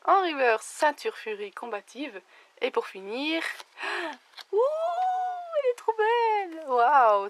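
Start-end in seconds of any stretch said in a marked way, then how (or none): 3.8-6.6 gasp, then a much higher cry of delight; sudden high thrill at a stunning card; excited gasp. Real, not acted. Opening a booster, thrilled by the rare card, gasping high. 6.7-7.3 amazed wow. Real, not acted. Amazed by the rare card.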